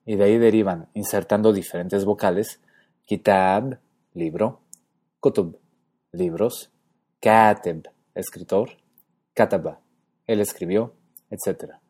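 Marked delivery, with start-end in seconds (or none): 3.1-3.8 kitab, con la k y la t bien marcadas; kitab en árabe. Shown as a demonstration. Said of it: "significan escribir o escritura". 7.2-7.9 kateb, la primera sílaba fuerte; kateb en árabe. Shown as a demonstration. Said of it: "significan escribir o escritura".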